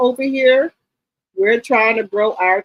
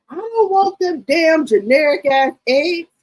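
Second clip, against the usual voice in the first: higher, slow insistent mother; mama's voice; 7 semitones higher than usual. Retold or imitated. Daughter imitating her mother refusing cheap eggs, slow and higher. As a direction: drag out the refusal like a stubborn mother.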